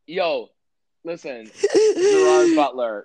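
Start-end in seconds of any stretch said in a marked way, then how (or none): 1.9-3.0 loud, high correction of the name; a sudden loud high exclamation; correcting loudly. Real, not acted. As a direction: shout the right name over her. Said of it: "He was in this movie called".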